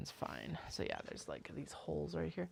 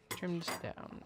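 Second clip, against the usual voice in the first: usual speaking voice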